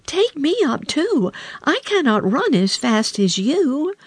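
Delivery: high, plaintive little frog; pleading frog. A narrator's reading. Frog begging the princess to carry him, high pleading. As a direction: beg to be carried, pitched high. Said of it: "cried the frog".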